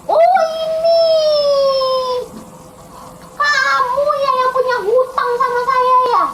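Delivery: high, loud accusing king. Acted. King confronting the servant who owes him, loud and accusing.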